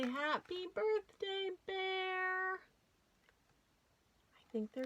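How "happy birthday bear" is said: higher, bright greeting from the bear's friends, lightly voiced; friends' cheerful greeting. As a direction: call out happy birthday like a crowd of little friends. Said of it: "And they say".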